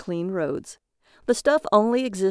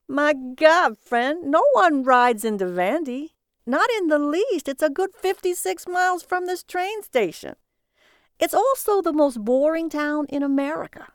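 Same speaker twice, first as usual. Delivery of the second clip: high, loud, brassy cab driver voice; brash cabbie; 8 semitones higher, 3 dB louder than usual. Acted. Cabbie needling his passenger, high and brash. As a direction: play the cabbie cocky and amused.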